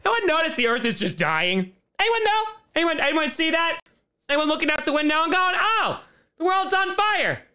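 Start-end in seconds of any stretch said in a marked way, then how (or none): 2.0-7.4 much higher, mock-innocent; high mock question; mocking viewer voice. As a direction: parody a doom-posting viewer.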